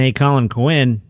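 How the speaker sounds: usual speaking voice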